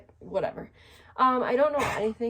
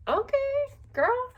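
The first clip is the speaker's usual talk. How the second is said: put-on very high, sassy "okay girl"; 4 dB louder, much slower than usual. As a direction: squeal it with sass. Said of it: "And I was like".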